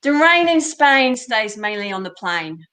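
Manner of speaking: Cockney demo line; Cockney accent. Shown as a demonstration. Coach showing the student how to try it in Cockney. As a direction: say it as a Cockney would. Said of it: "try it in a Cockney".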